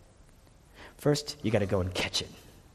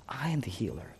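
whisper; 10 dB quieter than usual. Acted. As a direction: repeat "I am the healer" in an unvoiced whisper. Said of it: "He's saying".

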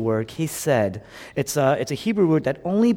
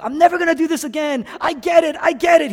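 high, loud, rushed plea; desperate cry; 6 dB louder, much faster than usual. Retold or imitated. Pastor voicing Jonah praying inside the fish, desperate cry. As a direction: cry out fast and high in prayer. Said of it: "Jonah cries".

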